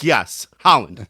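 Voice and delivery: loud, drawn-out mock exasperation; imitated emphatic answer. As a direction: mimic an impatient teammate, stretching the words. Retelling a frustrated teammate's answer.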